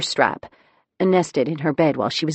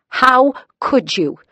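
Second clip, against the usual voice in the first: high loud slow wail; 9 semitones higher, 6 dB louder, slower than usual. A narrator's reading. Daughter wailing at her mother over the report. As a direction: wail it out, hurt and betrayed. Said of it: "she wailed".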